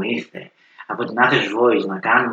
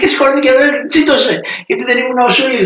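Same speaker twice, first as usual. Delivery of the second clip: much higher and louder, laughing disbelief; comic punchline; 14 semitones higher, 5 dB louder than usual. Retold or imitated. Telling viewers how the grandma got angry, high excited laughter in the voice. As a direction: deliver the punchline in amused outrage.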